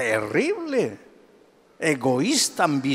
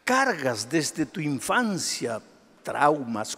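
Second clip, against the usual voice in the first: ordinary talk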